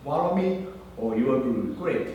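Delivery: normal talking voice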